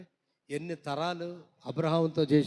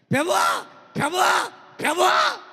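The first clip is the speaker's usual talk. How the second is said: high shouted cries of Lord; loud calling out. Retold or imitated. Preacher mimics a believer crying to God, shouting. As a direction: call out to the Lord at the top of your voice. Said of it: "ప్రభు అంటాడు".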